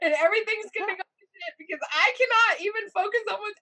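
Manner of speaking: high, loud, tearful panic; hysterical. Retold or imitated. Wife sobbing to husband on the stairs, hysterical. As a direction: wail it out like you're falling apart. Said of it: "I mean, I'm hysterical".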